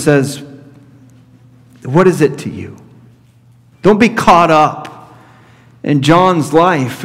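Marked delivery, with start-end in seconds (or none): none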